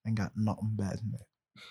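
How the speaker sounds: playful Southern slang voice; joking Southern accent; code switching. Acted. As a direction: lean into the country talk. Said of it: "I'm code switching right now".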